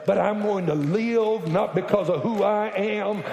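ordinary talk, nothing marked

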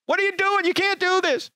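high, far-off scandalised bystander; protesting crowd at the tables; mock protester. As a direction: squeal like shocked onlookers. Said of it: "Everyone else is going".

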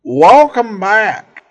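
ordinary talk, nothing marked

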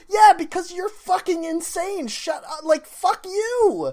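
high-pitched, indignant comic outburst; exasperated rant. Acted. Podcaster telling off life-hack people, high exasperated rant. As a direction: tell them off in a squeal of outrage. Acted retort to life hackers.

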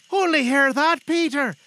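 high, loud motherly exclamation; excited mother. Acted. Mother to Peter about his prospects, excited. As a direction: call it out high and pleased. Mother calls to son in story.

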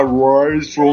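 imitating the game's bizarre synthesized voice; like an old arcade voice chip; old speech synthesizer. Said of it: "quem é que não lembra daquela voz bizarra".

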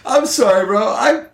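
high-pitched, apologetic dude voice; hacker buddy. Acted. Hacker apologizes to Finram for forgetting him, in a high voice. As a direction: excuse yourself in a high, laid-back put-on voice.

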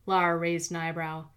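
usual speaking voice

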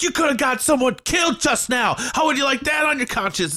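high, shouted mock scolding; mock Bruce Willis scolding. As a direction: yell it like a hammed-up hero.

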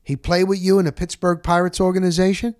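ordinary delivery

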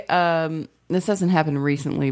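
ordinary delivery

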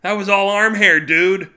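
loud, high outraged complaint; angry yell. Acted. Revived man scolding the one who ripped the Band-Aid, loud yell. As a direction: yell it at him, stinging.

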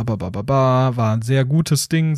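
usual speaking voice

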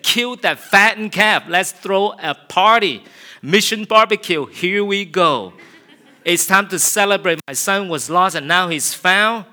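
loud, high celebrating call; joyful father. Acted. Father calling for a feast for his son, loud and joyful. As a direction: throw the party, shout it out.

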